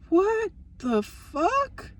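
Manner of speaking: stunned, slow, drawn-out disbelief; shocked. Retold or imitated. Reading her cousin's texts about Adam, slow stunned disbelief. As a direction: let it hang in slow shock.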